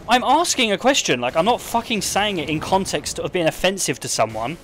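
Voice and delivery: high, loud indignant snap, easing off; indignant retort. Retold or imitated. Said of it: "and I was like".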